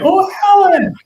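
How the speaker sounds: high, loud burst of pretend delight; hammed-up "of course I know them" cry; mock recognition. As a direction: exclaim as if you know exactly who it is.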